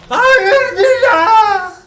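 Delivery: very high, loud laughing cry; anguished shout. Real, not acted. Crying out in dismay.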